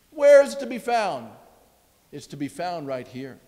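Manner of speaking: ordinary delivery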